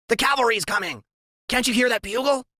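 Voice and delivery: high, excited announcing voice; Freak's high voice. A narrator's reading. Sidekick announcing rescue to his sinking friend. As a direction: proclaim it high and thrilled.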